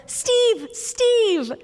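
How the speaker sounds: high, singing-out call of Steve's name; mom calling out. Retold or imitated. Mom hailing the nurse outside the hospital room, calling out high. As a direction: imitate a mom hailing someone, high and falling. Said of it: "I just hear her go".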